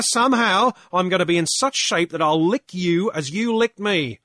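loud, high, fast determined vow; reformed drunk vowing to beat the captain; Pankburn, fierce vow. Acted. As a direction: swear revenge by getting fit. Pankburn vows to train.

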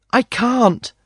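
put-on high girlish voice, refusing; girl's voice. A narrator's reading. Girl refusing the prisoner's plea, high girlish voice.

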